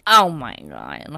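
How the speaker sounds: low, slow, drawn-out disbelief; scandalised. Real, not acted. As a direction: groan it in mock horror. Shocked reaction to scene.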